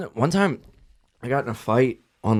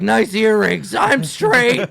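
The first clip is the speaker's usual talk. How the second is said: mock macho taunt; 6 semitones higher, 5 dB louder, much slower than usual. Retold taunt, high loud.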